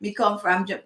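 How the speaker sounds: low, soft go at Jamaican patois; half-hearted Jamaican patois, trailing off; attempted Jamaican patois. Shown as a demonstration. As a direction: try out back-home Jamaican talk, quietly. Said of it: "I'm trying too hard".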